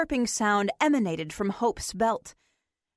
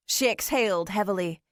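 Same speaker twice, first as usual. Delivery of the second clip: ordinary delivery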